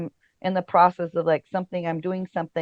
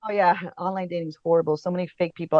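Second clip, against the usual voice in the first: ordinary delivery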